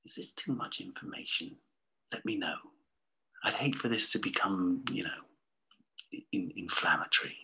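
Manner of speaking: soft, unvoiced confiding aside; hushed aside. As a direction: step out of the story and murmur. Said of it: "author's note".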